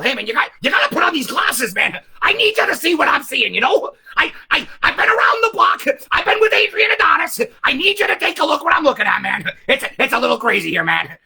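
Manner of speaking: frantic hoarse wrestler plea; Roddy Piper impression. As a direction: urge them wildly like Roddy Piper. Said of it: "It's not the best Roddy Piper".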